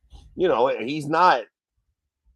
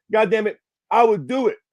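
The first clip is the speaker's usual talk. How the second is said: ordinary talk, nothing marked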